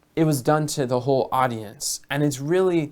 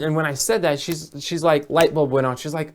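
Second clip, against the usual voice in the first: ordinary delivery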